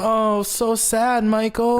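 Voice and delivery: mock sympathy. Teasing friend over the beer.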